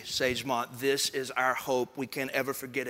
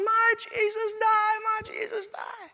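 put-on very high, slow, drawn-out toddler wail; imitated screaming child; slower than usual. Son screams as dad carries him out, mock wailing. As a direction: mimic a distraught small boy, fading off. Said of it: "He's screaming at top of his lungs".

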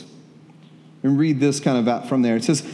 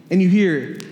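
ordinary talk

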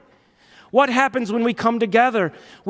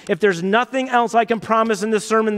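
ordinary delivery

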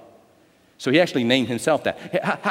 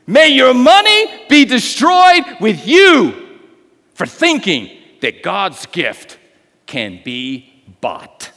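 slow shout, higher and louder, then falling; thundering rebuke; 7 semitones higher, 7 dB louder, much slower than usual. Acted. Peter rebuking Simon, shouting slowly. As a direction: roar down the curse.

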